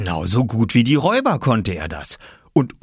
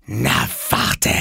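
higher, hissed threat; menacing hissed warning; angry hiss; 5 semitones higher than usual. A narrator's reading. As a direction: hiss it through the teeth as a furious girl. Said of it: "zischte sie".

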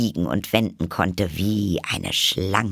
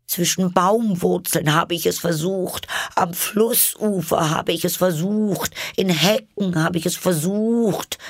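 high, wailing bird voice; lamenting pigeon; 10 semitones higher than usual. A narrator's reading. Pigeon tried every nesting place, wailing. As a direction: list each failure in a high wail.

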